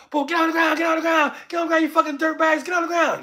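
high, loud rapid-fire shouting; acted police raid yelling; shouted police command. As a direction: yell orders like a cop on a raid.

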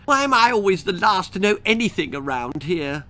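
high petulant fairy whine; whiny fairy. Acted. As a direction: complain like a spoiled fairy. Tooth Fairy complaining.

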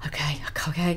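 unvoiced nervous okay, okay; shaky whisper. She relives the tense moment the rival backs off, whispering shakily. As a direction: breathe it out trembling with nerves. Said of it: "shaking like this".

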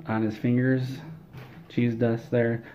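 ordinary delivery

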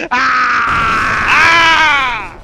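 loud, long yell; drawn-out shout that falls away; battle yell. Real, not acted. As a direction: holler wordlessly at full volume. Yelling in fight.

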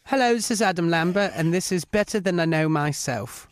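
hammed-up posh British radio intro; British accent. Singer introducing his single in a British accent. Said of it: "introduce your brand new single in that style".

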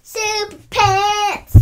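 loud, high and drawn out; show-host title shout; excited title announcement. Acted. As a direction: belt out the title slowly, like a big reveal.

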